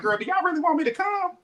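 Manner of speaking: high, pointed disbelief; high sassy question. Retold or imitated. Asking the couple if they want her, high and sassy. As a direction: toss it up high with sass. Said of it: "I'm like".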